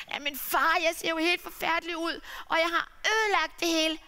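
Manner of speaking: mildly voiced ashamed son; pleading son. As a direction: son begging father to see. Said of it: "han lagde sig straks ned og sagde".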